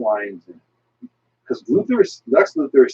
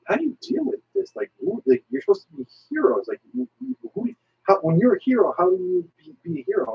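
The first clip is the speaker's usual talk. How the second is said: pitched way up, bewildered DC hero; a hammed-up puzzled superhero, much higher; high mock hero voice; 12 semitones higher than usual. DC hero asking Marvel heroes, high and baffled. DC character voiced in retold comic.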